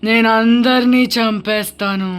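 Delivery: slow, drawn-out spooky drone; possessing ghost. Pretend. Ghost tells family it possessed Bunny, slow and eerie. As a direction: intone it like a haunting spirit.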